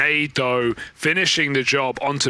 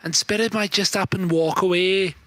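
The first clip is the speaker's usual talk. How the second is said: mock English caster voice; put-on English accent; 5 semitones higher than usual. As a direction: call the match in a hammed-up English accent. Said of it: "three different English accents".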